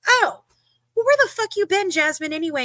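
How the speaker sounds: high-pitched retold confrontation; mock challenge. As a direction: call her out, pitched high then easing down. Retelling her challenge to Jasmine.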